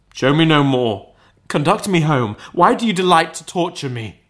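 high, loud anguished plea; desperate pleading. Acted. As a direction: beg the spirit to stop. Scrooge begs spirit desperately.

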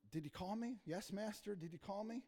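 soft, mild voice of a servant answering; lightly acted, softly asking the master; hushed questioning. Boy Samuel asks his master if he called, hushed questioning.

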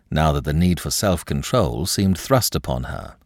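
ordinary delivery, nothing marked

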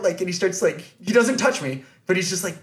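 ordinary talk, nothing marked

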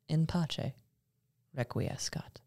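soft, fading to a whisper; hushed final rest in peace; whispered benediction. A narrator's reading. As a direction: breathe the blessing out. Solemn closing benediction.

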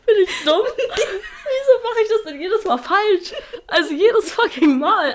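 high, loud self-mocking laugh through the words; cracking up at her own mistake, slowed and stretched; exasperated giggling at herself. Real, not acted. Mixes up game names again.

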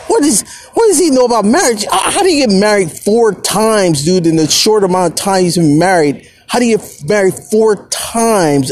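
high, fast, heated rant at a friend; fuming rant. Retold or imitated. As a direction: vent furiously about Steve Harvey. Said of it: "I was like".

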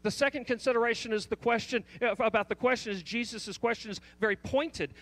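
ordinary talk

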